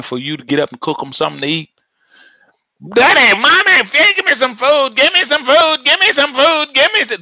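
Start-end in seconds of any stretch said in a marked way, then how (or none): 2.9-7.2 much higher, loud whining yell; screaming child. Retold or imitated. Child yelling at parents for food, shrill and loud. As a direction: shriek it like a demanding child. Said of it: "yelling and screaming".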